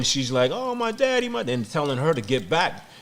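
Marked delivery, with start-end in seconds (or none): 0.5-1.4 high-pitched mock wail of a child; imitated crying. Retelling his daughter's cries at the hospital, imitated crying. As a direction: imitate a daughter wailing for her father. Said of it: "she's crying".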